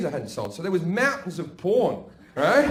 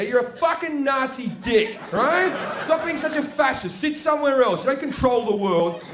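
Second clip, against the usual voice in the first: mock rant; 6 semitones higher than usual. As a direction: shout down chair-owning parents. Ranting at parents with own chair.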